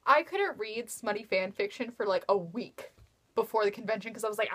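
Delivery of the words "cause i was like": ordinary talk, nothing marked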